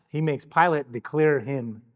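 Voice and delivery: ordinary talk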